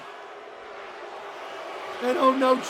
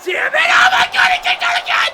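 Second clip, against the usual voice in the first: very high, loud outburst; shrieking frustrated amazement; astonished screaming; 8 dB louder, faster than usual. Real, not acted. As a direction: scream in disbelief at a near finish. Commentator astonished by second kickout.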